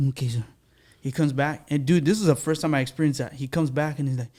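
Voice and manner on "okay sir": imitated soft, low acknowledgement; low-talking waiter. Waiter accepting a customer's order, low and soft. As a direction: answer like a hushed, obliging waiter. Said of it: "he's talking like that, low".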